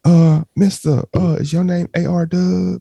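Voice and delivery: coy and drawn out, teasing; hammed-up effeminate voice cornering a guy; funny boy voice. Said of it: "they gonna be like".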